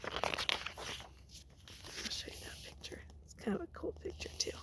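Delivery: soft unvoiced whisper, quick at the end; whispered aside. Real, not acted. Teacher whispers an aside to the class, hushed. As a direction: lean in and whisper it.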